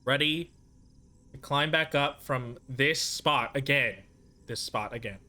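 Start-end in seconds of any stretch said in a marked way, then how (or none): 4.5-5.2 softer muttered echo to self; muttered repeat. Real, not acted. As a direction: mutter it under your breath.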